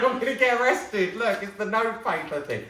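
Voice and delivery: high, loud, giggly squeal of guilt; rising into high, loud, laughing alarm; laughing mock panic. Real, not acted. Mock guilt over stolen hotel items.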